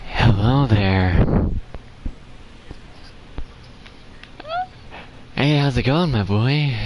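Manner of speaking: drawn-out unsettling monotone; creepy villain voice done on request. Shown as a demonstration. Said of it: "but my different voice is this".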